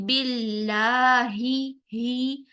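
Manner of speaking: باللہ slow, ending ہی very light and dropping; thin hi. Shown as a demonstration. Teacher demonstrating a thin final ہی. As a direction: let the voice and lips fall on ہی of باللہ. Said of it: "ہا کی زیر کو بہت باریک رکھتے ہوئے".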